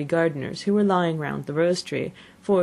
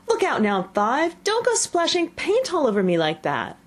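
sharp high warning call; 9 semitones higher than usual. A narrator's reading. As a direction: snap a warning at him. Gardener warns Five.

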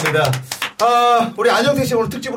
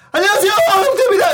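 high, loud, rapid-fire hello; overly polite greeting; 13 semitones higher, 5 dB louder, faster than usual. Retold or imitated. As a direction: belt out an eager, deferential introduction. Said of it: "정말 예의 바른 걸 보여주려고 인사하는".

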